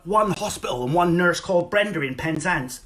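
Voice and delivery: ordinary talk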